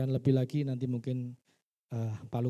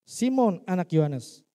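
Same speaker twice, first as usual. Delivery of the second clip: raised, louder call of a name; 4 semitones higher, 6 dB louder than usual. Acted. Jesus calls to Simon by the lake, louder calling voice. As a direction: call his name out firmly.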